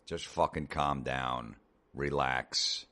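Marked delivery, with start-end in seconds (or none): none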